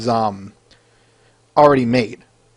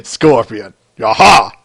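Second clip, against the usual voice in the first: Scorpion shout; 3 semitones lower, 5 dB louder, slower than usual. Retold or imitated. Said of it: "I don't know why I was thinking of that".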